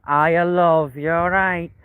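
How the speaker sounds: slow, stretched mock Manchester accent. Streamer comparing Manchester and Liverpool, slow mock Manchester accent. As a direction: drawl it like a Mancunian local. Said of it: "Manchester's like".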